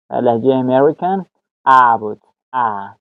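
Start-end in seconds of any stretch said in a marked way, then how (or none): none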